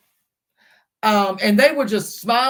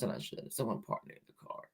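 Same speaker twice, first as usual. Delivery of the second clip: whispered aside; 10 semitones lower, 18 dB quieter than usual. Real, not acted.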